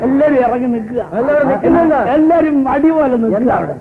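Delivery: high, bustling host voice; fussy wedding host. Acted. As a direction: herd the guests in a busy fluster. Host bustles guests about.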